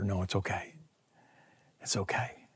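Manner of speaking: soft whisper; calm a clinging friend gently; whispered comfort. Retold or imitated. Jesus gently calming Mary.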